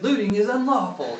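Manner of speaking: Finn. Retold or imitated. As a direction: mimic a pious companion laying down the law. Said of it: "Finn's voice".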